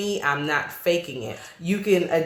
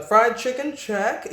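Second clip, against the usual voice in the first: raised mock-politician voice; pandering politician; 6 semitones higher, slower than usual. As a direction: tick it off like a pandering candidate. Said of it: "politicians or something like that, where they're like".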